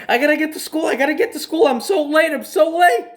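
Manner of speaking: put-on a panicking kid who thinks he's late, squeaking in a rush; high frantic panic. Kid to his aunt at the door, high panicked squeal. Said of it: "And I'm like".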